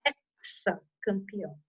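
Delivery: "hexacampeão" with X said as KS. Shown as a demonstration. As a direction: say "hexacampeão" starting EK-sa. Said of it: "São dois sons diferentes".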